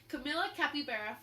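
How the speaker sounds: plain reading voice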